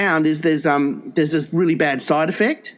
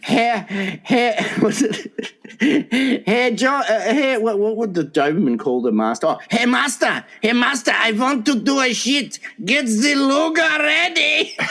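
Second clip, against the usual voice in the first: a mock German Doberman, higher, laughing; lightly hammed German dog begging its master; German accent; 8 semitones higher than usual. Doberman asking its master, mock German accent. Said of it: "Where's the German accent?".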